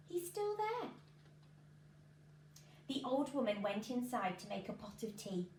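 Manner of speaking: usual speaking voice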